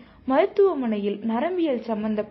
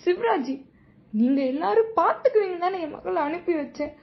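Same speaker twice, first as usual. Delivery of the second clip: much higher and louder, breaking into sobs; sobbing accusation; 7 semitones higher, 3 dB louder than usual. A narrator's reading. Mother reproaching the deputy before choking up, sobbing. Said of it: "மேல் சொல்ல இயலாமல் விசும்பியவர்".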